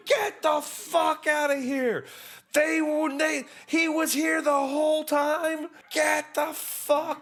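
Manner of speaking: high, slow, stretched-out scream of disbelief. Retold or imitated. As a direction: yell it like you can't believe what you're seeing. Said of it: "I was in the kitchen going".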